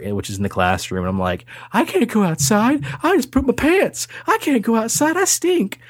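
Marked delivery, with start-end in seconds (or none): 1.7-5.8 put-on high whiny child voice, rising into a wail; panicked little kid. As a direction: play a scared kindergartner begging not to go out. Kid panicking after accident.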